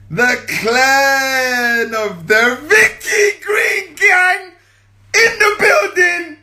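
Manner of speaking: slow, high, loud announcer cry; hype call. Pretend. Calling out the winner's gang to her viewers.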